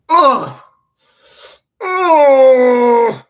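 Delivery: high, loud "ugh", then held long; loud groan. Acted. Acting out a hit, loud high groans.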